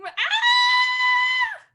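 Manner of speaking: high, loud playful scream; mock scream. Teacher shows the kids a crocodile scream. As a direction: let out a big pretend shriek. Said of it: "You might scream".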